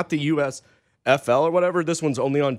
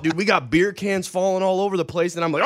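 normal talking voice

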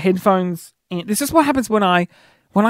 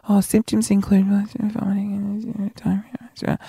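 slow, whispered mumble; inaudibly soft-spoken chemist; 3 dB quieter, slower than usual. Retold or imitated. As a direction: mimic someone too soft to be heard, words melting away. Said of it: "I didn't hear her".

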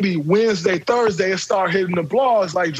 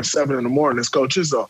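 ordinary delivery

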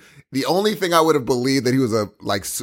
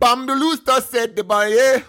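higher, slow fake Jamaican accent; mock Jamaican accent; 9 semitones higher, slower than usual. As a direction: imitate a bad Jamaican accent in the song. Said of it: "the bad Jamaican accent".

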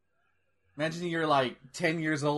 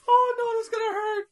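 high, hammed-up whimpering wince; mock crying. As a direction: whine it through fake tears. Wincing in mock tears.

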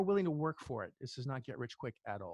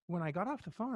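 normal talking voice

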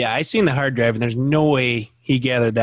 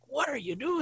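exasperated; 13 semitones higher, 10 dB quieter than usual. Retold or imitated. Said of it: "she's just like".

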